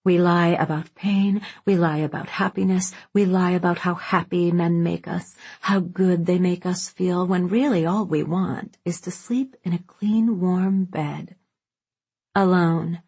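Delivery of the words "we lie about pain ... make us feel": plain reading voice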